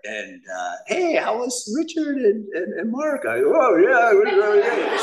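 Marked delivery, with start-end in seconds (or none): 0.9-3.2 high, light imitation of his wife; wife's voice. Wife greets him coming home, imitated high. 3.5-5.0 high, louder overeager denial; high nervous cover. Retold or imitated. Husband hides his high from wife, overbright. High trying to sound normal.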